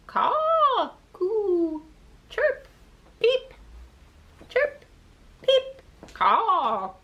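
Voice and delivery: high chirpy bird calls. Acted. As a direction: chirp them like little birds. Birds calling.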